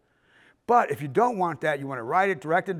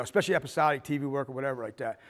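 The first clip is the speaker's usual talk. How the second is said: ordinary talk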